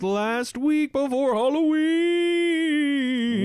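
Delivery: high, slow spooky drawl; spooky announcer voice. Pretend. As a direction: stretch Halloween out like a horror trailer. Announcing Spooky Month, Halloween.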